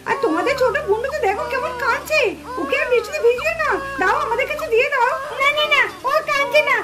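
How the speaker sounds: ordinary talk, nothing marked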